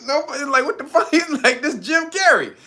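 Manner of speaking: high, small mock-stunned voice; tripping, baffled. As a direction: imitate disbelief in a high squeak. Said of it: "he was tripping like".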